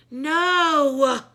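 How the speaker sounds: loud, high, drawn-out no; know-it-all girl correcting a classmate; scornful no. A narrator's reading.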